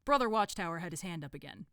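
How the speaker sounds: plain reading voice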